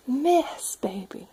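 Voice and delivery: whispered mess with a lift; playful whisper. Acted. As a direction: breathe the word out like a secret. Reading book to toddler, playful.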